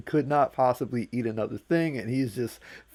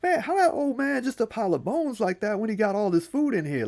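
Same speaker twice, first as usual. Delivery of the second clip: high, amused trickster voice; high, half-laughing disbelief; Anansi marveling; 10 semitones higher than usual. Acted. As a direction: laugh at the old man's hoarding. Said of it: "It's like".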